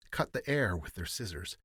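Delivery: usual speaking voice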